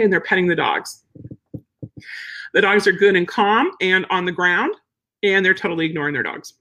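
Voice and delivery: usual speaking voice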